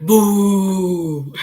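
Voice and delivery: loud boo that breaks into a laugh; startling boo. Shown as a demonstration. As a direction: jump out and shout boo, laughing. Demonstrating a scary boo.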